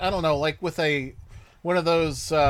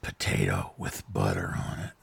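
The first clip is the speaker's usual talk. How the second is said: deep mock sexy voice; 5 dB quieter than usual. Co-host quoting the cartoon's sexy voice, very deep and hushed. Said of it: "she runs up and says".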